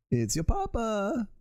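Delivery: put-on high, drawn-out German-accented papa calling; hammed-up German sing-out, pitched high; movie German accent. Papa searching for Valheim, high movie German accent. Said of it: "movie version of a, of a German accent".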